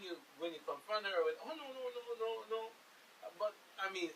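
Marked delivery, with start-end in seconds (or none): 1.4-2.7 higher, softer mock denial; a lightly voiced caught-out caretaker brushing it off; caretaker's denial. Blind caller imitating caretaker denying stealing, light mock denial. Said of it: "she's like".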